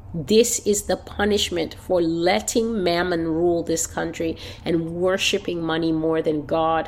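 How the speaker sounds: ordinary delivery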